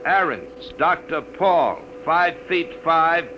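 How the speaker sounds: slow, measured on-air broadcast read; radio announcement. Acted. Charlie reads missing persons list over the air, announcer read.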